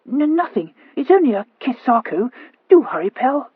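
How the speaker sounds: high, flustered stammer; a rattled girl covering herself. A narrator's reading.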